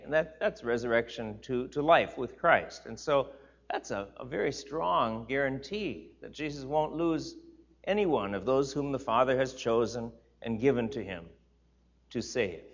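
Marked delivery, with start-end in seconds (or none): none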